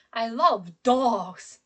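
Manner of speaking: "dogs" stretched out and said stronger; slow down and hit "dogs"; stress on DOGS. Shown as a demonstration. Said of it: "I pronounce the word dog stronger".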